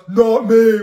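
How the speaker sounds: sister's quick loud "not me"; loud retold denial. Retelling the sister's loud denial over the pizza. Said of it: "And my sister goes".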